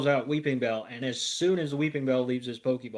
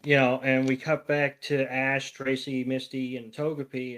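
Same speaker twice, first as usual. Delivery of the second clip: ordinary talk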